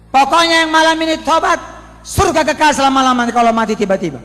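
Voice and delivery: loud, high hellfire warning; fiery preaching shout. Retold or imitated. Preacher shouts repentance over the noisy funeral, loud and urgent. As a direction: thunder the warning at the crowd.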